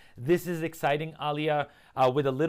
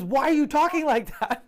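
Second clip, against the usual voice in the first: laughing imitation of an irritated colleague, much higher and louder; hammed-up annoyance, pitched way up through laughter; mock irritated; 11 semitones higher, 5 dB louder than usual. Imitating an irritated colleague who hates the dull voice, mock annoyed. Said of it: "he was so irritated".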